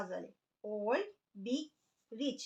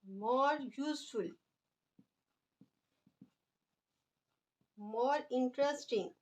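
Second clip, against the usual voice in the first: very slow, each word drawn out for writing; slow dictation; much slower than usual. Shown as a demonstration. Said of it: "अब हम देखें इनकी second degree बनाते हैं". (बहुत धीरे-धीरे लिखवाते हुए)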